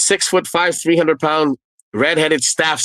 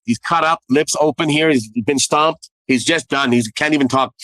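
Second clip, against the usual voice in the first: ordinary delivery, nothing marked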